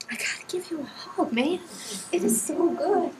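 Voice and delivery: high, tearful and overwhelmed; real happy tears in the voice; moved to tears. Real, not acted. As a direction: let the emotion lift the voice, slowing down. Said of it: "I'm not afraid to cry".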